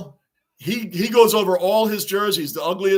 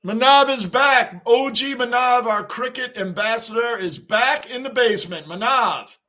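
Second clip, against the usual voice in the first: excited welcome; 5 semitones higher, 3 dB louder than usual. Real, not acted. Greeting a returning viewer.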